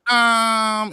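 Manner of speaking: hummed comedian impression; Cat Williams. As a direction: mimic a roast comic winding up. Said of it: "better than Cat Williams".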